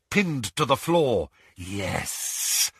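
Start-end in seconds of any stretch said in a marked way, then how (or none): 1.5-2.7 hushed, low snake voice; whispered. A narrator's reading. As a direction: whisper it like a serpent. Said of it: "whispered the voice".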